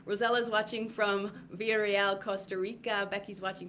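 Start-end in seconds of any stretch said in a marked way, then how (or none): none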